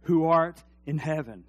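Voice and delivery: slow, measured prayer recital; leading the church in prayer, slow and solemn. Shown as a demonstration. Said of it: "Say it with me".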